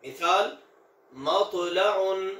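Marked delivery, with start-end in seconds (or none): 1.1-2.3 मतलउन with a thick, heavy tau and a hard jolt, slowly; Arabic tau, thick and hard. Shown as a demonstration. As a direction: say मतलउन with a full, jolted tau. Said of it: "तौ की आवाज मोटी और झटके वाली".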